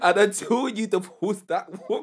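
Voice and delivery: giggling through a mock preacher line; laughing impression. Friend retells the doctor's video, laughing impression. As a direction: quote him while cracking up. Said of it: "That video of him saying".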